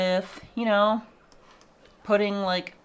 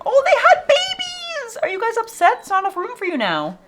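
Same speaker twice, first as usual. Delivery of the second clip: much higher, louder, laughing squeal; delighted surprise; 7 dB louder than usual. Real, not acted. Streamer finds baby animals, squealing with surprised laughter. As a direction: shriek of delight at the babies. Discovers babies in small habitat.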